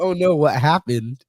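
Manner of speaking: playful put-on little-kid dismay; mock-innocent kid. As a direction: imitate a child feigning innocent surprise. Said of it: "And then she goes".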